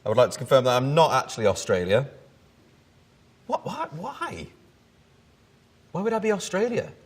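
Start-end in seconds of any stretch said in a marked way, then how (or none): 3.5-6.9 baffled. Real, not acted. Baffled by an odd search.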